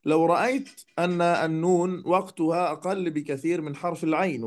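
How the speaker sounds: normal talking voice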